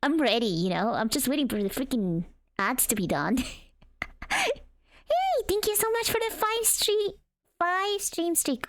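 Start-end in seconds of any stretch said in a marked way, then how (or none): 4.3-8.6 high, bright thank-you; excited greeting. Real, not acted. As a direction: squeal out the thanks. Excited thanks for a stream streak.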